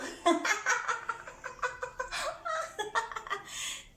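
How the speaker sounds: long, high staccato giggle; drawn-out pretend laughing fit; demonstrated laugh. Shown as a demonstration. Demonstrates laughing when something is funny.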